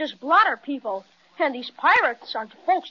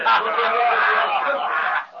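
pirates laughing; 5 semitones lower than usual. Acted. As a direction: laugh at them like rough pirates. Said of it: "the way they're laughing".

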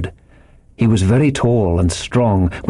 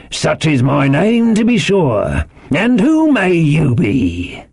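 slow, drawn-out higher sailor voice; Long John Silver; 6 semitones higher, slower than usual. A narrator's reading. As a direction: genial old seadog sizing up a newcomer. Silver greets the boy.

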